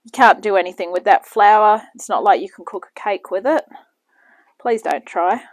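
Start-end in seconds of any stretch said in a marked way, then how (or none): none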